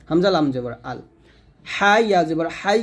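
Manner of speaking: normal talking voice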